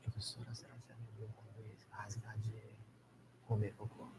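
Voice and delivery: hushed whisper to a colleague; whispered question, polite but pointed. Retold or imitated. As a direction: whisper it so no one else hears. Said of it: "falei baixinho".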